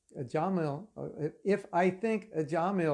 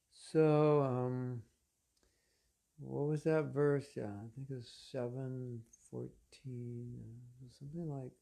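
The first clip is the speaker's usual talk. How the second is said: halting, low mumble full of ums; mock hesitant droning, trailing very soft; slow, boring lecturer; 3 semitones lower, 4 dB quieter than usual. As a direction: imitate a dull teacher groping for the verse. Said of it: "if you talk too slow, it's kind of boring".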